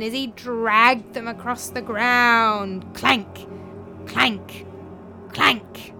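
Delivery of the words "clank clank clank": sound-effect clanks. Acted. Imitates the ghost's dragging chains.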